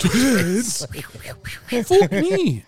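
character voice. Retold or imitated. Quoting Austin Powers.

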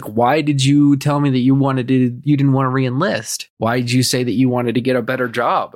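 normal talking voice

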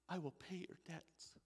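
Yahweh speaking gently. Acted. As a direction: answer softly from above, unhurried. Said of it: "he spoke to me and just said".

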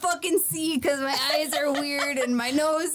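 put-on cartoon henchman voice; hammy character complaint; goofy sidekicks. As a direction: moan it like a silly sidekick. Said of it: "her sidekicks come out and they're like".

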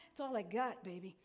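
usual speaking voice

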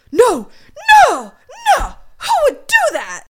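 high, loud, hammed-up horrified no; mock shock. Suspect hearing of the murders, hammed-up shock. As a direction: overplay the disbelief.

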